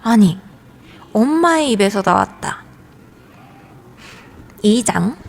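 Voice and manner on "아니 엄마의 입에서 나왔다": usual speaking voice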